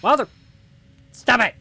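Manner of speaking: high, loud yell; loud exasperated shout. Real, not acted. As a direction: yell it in frustration. Outburst at game character.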